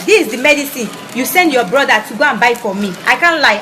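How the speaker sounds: ordinary talk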